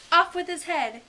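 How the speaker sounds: high, loud royal command; shouting. Acted. The Queen quarrelling with other players, shouting. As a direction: shout it as a furious queen's order.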